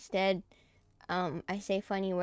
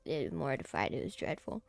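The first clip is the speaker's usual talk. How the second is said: ordinary talk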